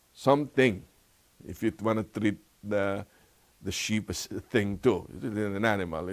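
normal talking voice

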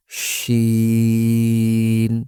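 শিন with sh and a very long level vowel; long-drawn শিন. Shown as a demonstration. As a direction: stretch the i of শিন on one note. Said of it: "তিন আলিফ পরিমাণ টান". (শিন লম্বা টেনে)

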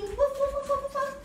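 high, quick string of woofs; running dog bark. Pretend. Teacher says the dog is running, high playful barks. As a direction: yap playfully as a running pup.

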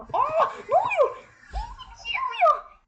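sudden high startled cries. Real, not acted. Gamer startled as the game glitches, high and alarmed. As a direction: react to something going wrong.

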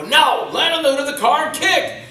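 high, loud stunt coordinator shout; imitated yelling. Stunt coordinator correcting a botched take, mock yelling. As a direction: bellow it as an exasperated order. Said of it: "he's yelling at me".

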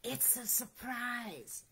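very soft, secretive hush; whispered. A narrator's reading. Rabbit letting Owl in on a surprise, whispered. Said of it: "whispered Rabbit".

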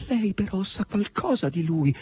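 high, pleading question; imploring. A narrator's reading. Margherita asks Asasello about her lover, imploring. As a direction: beg for news, not truly whispered. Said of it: "sussurrò Margherita con tono implorante".

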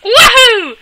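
very high loud whoop; Mario whoop. Real, not acted. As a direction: cheer like Mario jumping. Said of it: "Sound like Mario".

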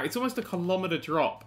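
ordinary delivery

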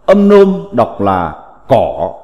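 usual speaking voice

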